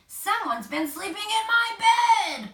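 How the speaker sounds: roaring Papa Bear. A narrator's reading. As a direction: roar at the slept-in bed. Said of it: "Papa Bear roared".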